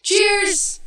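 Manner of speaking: loud, high, jubilant cheer; big festive shout; celebratory toast. Acted. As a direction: call it out to toast a friend. Group toast to new job.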